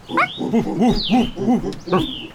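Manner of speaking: a real hearty laugh. Real, not acted. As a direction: let out a familiar warm laugh. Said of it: "I miss that sound".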